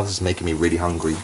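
ordinary talk, nothing marked

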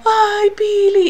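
a drawn-out, affectionate coo; tender coo. Retold or imitated. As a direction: melt over a friend's good news. Friend tenderly hugs her.

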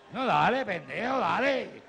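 loud, rising shout at the TV; mock yell at the screen; fan yelling. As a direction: urge the boxer on, frustrated and laughing. Fans yelling at the TV.

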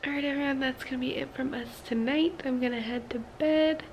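very soft, near-whispered goodnight; hushed sign-off. Real, not acted. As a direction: sign off very quietly, fading into a whisper. Signing off at bedtime, hushed.

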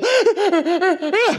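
a hammed-up yawning gasp; mock yawn gasp. Shown as a demonstration. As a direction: gasp like a yawn taking over your body. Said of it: "When you yawn, you go".

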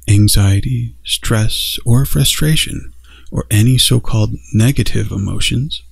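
gentle meditation guide growing hushed; guided meditation. Acted.

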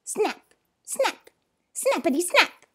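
high, sharp, separated snaps; snapping. Acted. Turtle snapping again and again, sharp snapping. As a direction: snap each word out crisply. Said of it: "snapping turtle snapped again".

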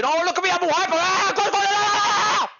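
high, loud mocking of an overexcited fan; mock hyper fan. Streamer mocks the hyper fan who DMed him, high and loud. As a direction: ham up a hyper fanboy, ending in yelps. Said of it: "You're D Ming me to get my attention".

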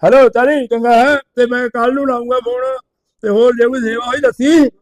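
put-on loud, high, rowdy drunk ribbing; drunk rant. Drunk friend on the phone rants loudly at the narrator. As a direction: hammer the insults like a merry drunk. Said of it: "ਨਸ਼ਾ ਪੱਤਾ ਕਰਕੇ ਟੈਟ ਹੋਇਆ ਫਿਰਦਾ".

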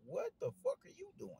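very high-pitched incredulous quote; mock disbelief. Fan questioning the ref stopping the fight, high-pitched mock disbelief.

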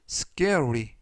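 usual speaking voice

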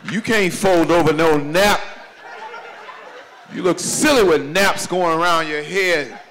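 normal talking voice

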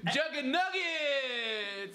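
high, drawn-out hype announcement; announcer shout. Pretend. Introducing the special guest MC, drawn-out announcer call. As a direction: stretch the name out high like a ring announcer.

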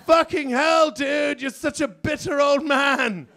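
high, loud mocking yell; high hammed-up contempt; scornful shout. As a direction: yell it at him with disdain. Indian elephant snaps back.

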